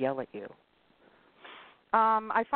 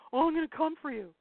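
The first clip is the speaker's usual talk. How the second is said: mocking eager sub; 10 semitones higher, 3 dB louder than usual. Mocking subs' eager offers.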